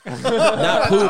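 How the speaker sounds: loud laughing denial; high laughing correction. Real, not acted. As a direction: blurt it out through laughter.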